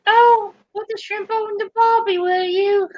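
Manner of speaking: high-pitched mock Australian accent; bad Australian accent. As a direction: do a hopelessly fake Australian housewife accent. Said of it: "the worst accent, Australian accent ever".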